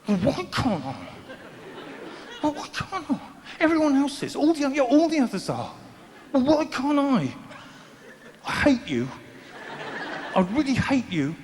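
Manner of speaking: put-on sulky teen yelling at mum on the phone; mock teen tantrum.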